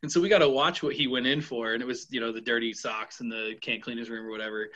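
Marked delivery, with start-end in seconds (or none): none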